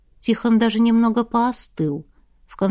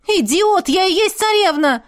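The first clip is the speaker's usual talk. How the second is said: angry shouting; 8 semitones higher, 4 dB louder than usual. Acted. Furious shouted quarrel.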